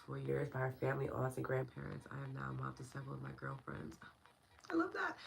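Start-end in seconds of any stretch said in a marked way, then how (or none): 4.7-5.1 high, bright-eyed delight; light up at the comment. Real, not acted. Delighted reaction to viewer comment.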